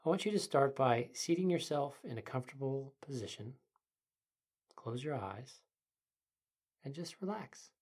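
soft, quiet meditation guide; sleep story narrator. Acted. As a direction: ease the listener into calm.

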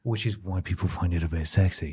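low, soft ASMR-style demo; mock-intimate close-mic voiceover; up close and personal. Shown as a demonstration. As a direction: keep it hushed, close to the mic. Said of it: "up close and personal like this".

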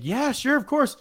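high, fast, chatty bystander voice; imitated obliging lady. Voices the lady asked for photo.

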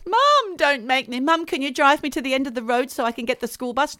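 high, whining plea to Mum, then rushed; pleading child. Acted. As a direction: play a kid begging Mum for a lift.

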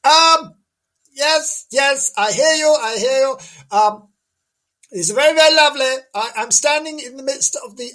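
high-pitched comic lady reporter; fluting on-the-spot correspondent, put on; Janice. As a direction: play a dotty reporter in a high voice. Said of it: "we were gonna talk to Janice".

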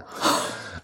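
sharp voiceless gasp; shocked gasp. Real, not acted. Hearing the stranger's cab confession, shocked gasp.

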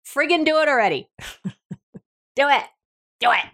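exasperated loving push to just do it. Real, not acted.

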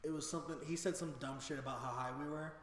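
ordinary talk, nothing marked

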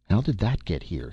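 usual speaking voice

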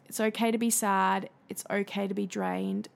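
ordinary talk, nothing marked

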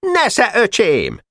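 high, drawn-out battle cry; taunting shout. A narrator's reading. Barabás flinging sand bombs at Pásztor, taunting shout. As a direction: cry it out slowly as you throw. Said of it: "minden bombával kiáltott egyet Barabás".

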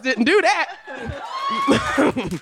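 laughing denial. Acted.

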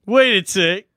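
ordinary talk

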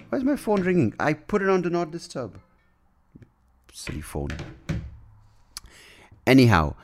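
ordinary talk

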